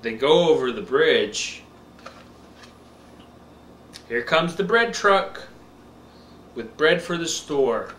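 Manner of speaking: slow, bright picture-book reading; reading to a toddler. Acted. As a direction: read it slow for a little one.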